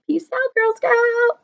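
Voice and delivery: high, sing-song farewell; playful sign-off. Real, not acted. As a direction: toss it off playfully.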